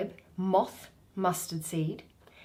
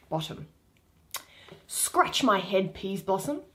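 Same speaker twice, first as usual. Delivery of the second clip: plain reading voice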